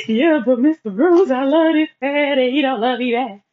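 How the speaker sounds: reliving the heartbreak through tears; crying plea. Retold or imitated. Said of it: "So I'm crying to Mister Bruce".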